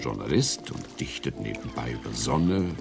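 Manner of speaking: ordinary talk, nothing marked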